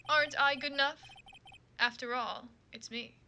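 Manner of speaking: normal talking voice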